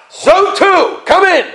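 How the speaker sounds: high, triumphant boarding call; proud announcer. Retold or imitated. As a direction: call passengers in as if running the world. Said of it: "she's all proud saying".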